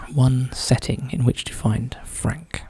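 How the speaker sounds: slow whispered repeat of the clue. Real, not acted. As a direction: whisper it slowly to yourself.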